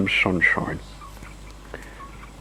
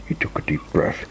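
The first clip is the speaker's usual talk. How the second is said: ordinary delivery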